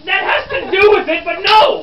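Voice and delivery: high, loud rejection; exasperated shout. Acted. Reporter rejecting a student's answer, shouting. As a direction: concede and refuse at full volume.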